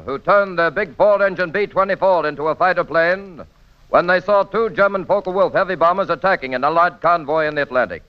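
ordinary talk, nothing marked

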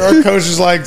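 ordinary talk

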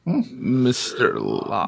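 slow, drawn-out spectral voice; haunting voice. Acted. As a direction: speak like a lingering ghost. Ghost names his killer.

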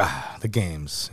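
low, slow, soft retold groan; deflated sigh. Co-host retelling how he remembered the games, deflated sigh. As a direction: sigh it out softly, dropping low. Recalls his letdown at the games.

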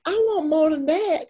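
high, laughing Southern boss voice; Southern US accent. Retold or imitated. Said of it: "you are so good at the accent".

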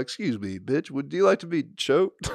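mock smooth pickup. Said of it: "you come in just like".

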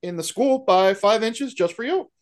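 hammed-up, accommodating Canadian; Canadian accent. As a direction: reassure them in a folksy Canadian accent. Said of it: "whole fucking movie in Canadian accents".

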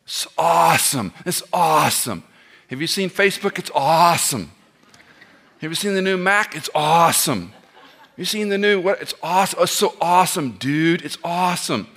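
lightly mock the people who overuse awesome; gently parodied gushing enthusiasm. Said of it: "I hate when people use the word awesome".

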